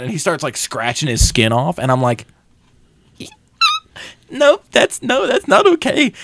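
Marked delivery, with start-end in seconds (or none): 4.3-6.1 very high-pitched protest; mock squeamish refusal. Viewer watching demon scratch his skin, high uneasy refusal. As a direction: back away from it in a high voice.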